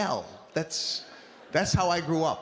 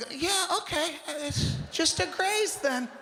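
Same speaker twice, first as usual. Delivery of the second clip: much higher, strained forced calm; suppressed anger; 12 semitones higher than usual. Retold or imitated. Husband swallowing anger at wife's damage claim, strained. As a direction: hold down rage with a tight high voice. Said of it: "I'm sitting in the kitchen like".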